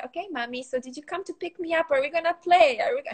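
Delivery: lightly voiced toddler chatter; chatty toddler. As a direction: play the bright little girl. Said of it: "she does tend to say".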